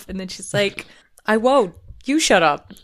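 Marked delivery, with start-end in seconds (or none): 1.2-2.7 firm, raised-volume comeback; loud but not screamed retort; mock retort. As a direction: imitate a stubborn answer in a spat. Acts out the answer in quarrel.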